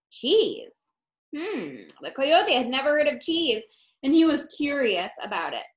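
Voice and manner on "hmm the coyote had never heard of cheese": ordinary delivery, nothing marked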